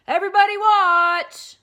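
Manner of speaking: very loud, high cry; excited cry. Acted. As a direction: shout for everyone to watch. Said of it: "he cried".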